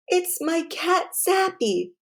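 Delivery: sniffling. A narrator's reading. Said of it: "Caitlin sniffed".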